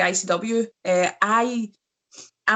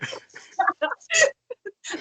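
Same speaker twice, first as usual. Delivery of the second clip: big high-pitched laugh; real amused laugh. Real, not acted. Laughs at own slip.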